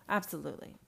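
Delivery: low soft Absolutely, let it drop; low, quiet. Acted. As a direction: murmur Absolutely under the breath. Same word said twice.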